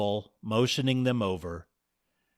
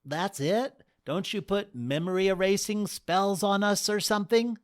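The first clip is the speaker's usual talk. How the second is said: lightly voiced groom, higher; a groom puzzled by the goddesses; baffled groom; 10 semitones higher than usual. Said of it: "asked Dale".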